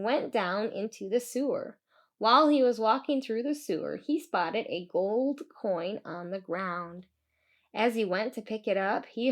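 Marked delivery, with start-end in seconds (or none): none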